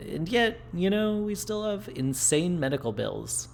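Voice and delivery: can't believe it, voice climbing; incredulous. Real, not acted. Incredulous at dystopian medical bills.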